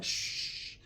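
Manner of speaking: soft unvoiced shush; secretive shush. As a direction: hush them conspiratorially. Hushing the homies secretively.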